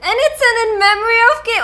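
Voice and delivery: fast tearful sob-talk; crying. Real, not acted. Streamer learns the game honors a late founder, crying. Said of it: "We're losing tears in a pile".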